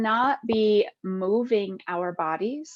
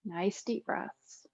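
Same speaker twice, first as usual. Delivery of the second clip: guided meditation; 4 dB quieter than usual. Acted. Yoga teacher guiding listeners lying with eyes closed, calm meditation voice. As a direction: ease them into stillness, phrase by unhurried phrase.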